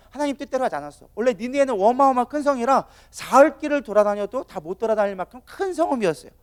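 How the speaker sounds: normal talking voice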